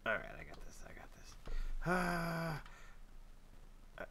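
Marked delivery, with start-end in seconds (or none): 0.0-1.3 whispered to himself, steadying; whisper, talking yourself into it; a real whispered pep talk; whispered self-pep talk. Real, not acted. Psyching himself up mid-game.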